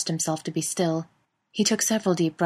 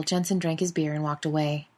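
ordinary delivery, nothing marked

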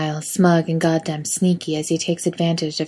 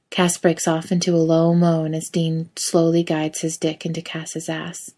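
ordinary delivery, nothing marked

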